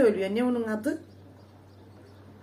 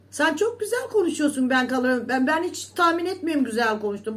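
ordinary talk, nothing marked

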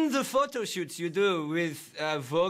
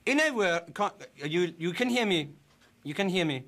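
put-on broken English with a German accent. A German-accented interviewer greets his guest politely. Fake foreign interviewer throughout.

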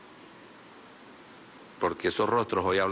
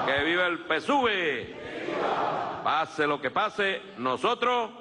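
booming balcony speech; 6 semitones higher, slower than usual. Real, not acted. Rally chants to crowd.

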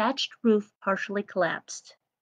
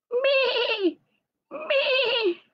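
very high bleating Me; sheep bleating. A narrator's reading. Lamb Lumi crying from the well, high bleat. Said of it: "Lumi was bleating loudly".

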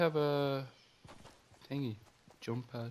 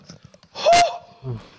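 breathless laughing gasp; 12 dB louder than usual. Real, not acted. After the big play.

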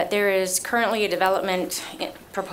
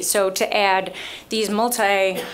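ordinary talk

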